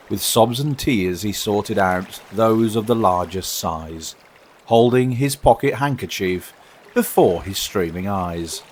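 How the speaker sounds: ordinary delivery, nothing marked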